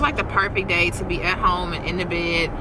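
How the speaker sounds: ordinary talk, nothing marked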